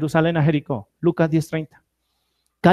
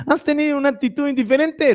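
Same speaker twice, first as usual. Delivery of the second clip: playful high question; 7 semitones higher than usual. Shown as a demonstration. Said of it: "Ah, bueno, tienen que reírse".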